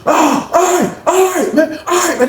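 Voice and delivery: high strained giving up; retold pained surrender. Honey bun man giving up under officers, high pained surrender. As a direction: yield while pinned down. Being subdued by police.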